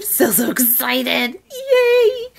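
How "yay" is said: happy cheer. Real, not acted. Joyful sign-off cheer.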